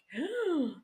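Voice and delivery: high-pitched gasp of alarm; mock gasp. Speaker imitates her reaction to big goals, mock gasp. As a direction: gasp as if daunted.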